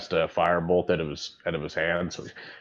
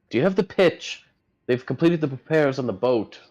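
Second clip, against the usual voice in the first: put-on higher, louder old man voice; Edmund's voice; 7 semitones higher, 4 dB louder than usual. Edmund asking the party about the pitch, high. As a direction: ask eagerly about the pitch. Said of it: "he's like".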